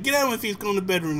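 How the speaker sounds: high-pitched retold scolding; exasperated dad, acted out; mock angry dad. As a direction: imitate a parent laying down the law. Retold angry dad sending kid away.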